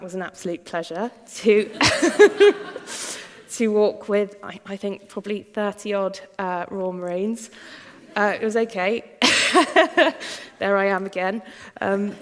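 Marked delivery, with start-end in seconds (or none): 1.8-3.3 high breathy laugh; airy laugh rising high; cheeky giggle. Real, not acted. Cheeky laugh about walking with Marines. 9.1-10.5 bright laugh, pitched up and louder; quick amused chuckle. Real, not acted. Laughs at own photo.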